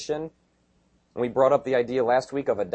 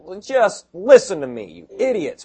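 higher and louder, a hammed-up harsh rebuke; imitating a scornful scolding apostle; mock scolding; 6 semitones higher, 7 dB louder than usual. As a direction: snap at them in jest like a scornful teacher. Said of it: "He could have said".

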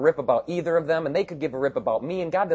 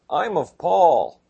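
mocking quote; 5 semitones higher, 5 dB louder, much slower than usual. Mocking factional church members.

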